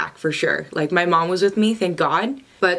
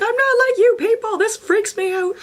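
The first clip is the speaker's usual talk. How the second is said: high-pitched, comic freak-out; mock-panicked squeaky protest; freaked-out inner voice. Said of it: "In my head, I'm like".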